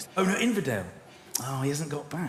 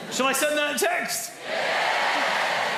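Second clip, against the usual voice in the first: excited shout; 9 semitones higher, slower than usual. Real, not acted. Host hyping the crowd to send it, excited shout. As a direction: whip up the studio crowd.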